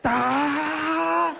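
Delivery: a high, loud straining cry; battle yell. Retold or imitated. Fighter charging his punch lets out a battle yell. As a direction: yell with every bit of power you have. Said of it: "I'm yelling".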